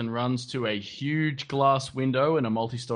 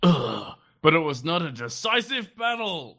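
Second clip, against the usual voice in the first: stern commander; 9 semitones higher than usual. Retold or imitated. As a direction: play a stubborn old general. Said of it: "And he's like".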